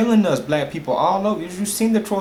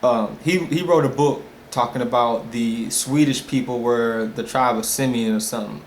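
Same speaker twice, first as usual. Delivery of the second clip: normal talking voice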